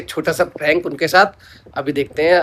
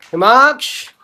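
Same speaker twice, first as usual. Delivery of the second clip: drawn-out name call with rising end; 5 semitones higher, slower than usual. Real, not acted. Calling the child by name. (पुकारते हुए)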